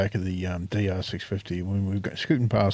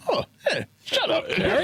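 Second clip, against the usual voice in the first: much higher mocking child voice; cheeky kid voice. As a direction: sass her like a little kid.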